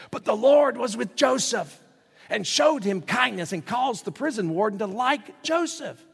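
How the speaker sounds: high, loud, rousing preacher's delivery; exultant scripture reading. Real, not acted. Preacher reads the awesome verse to congregation, exultant. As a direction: proclaim it high and full of excitement.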